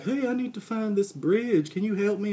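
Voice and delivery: high, loud voice asking for directions; acted handsome stranger. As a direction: call up to the porch, pitched well above your own. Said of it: "And he's like".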